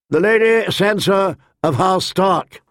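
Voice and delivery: high, projected announcement; herald's cry. A narrator's reading. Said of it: "the herald cried".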